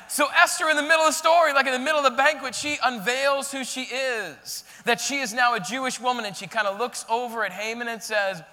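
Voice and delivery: loud, fast, excited retelling; preaching at full force. Acted. Pastor retelling the banquet to the church, full-force preaching.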